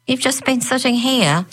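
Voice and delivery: 高声惊讶地问; high, disbelieving question; incredulous. A narrator's reading. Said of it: "Lisa said incredulously".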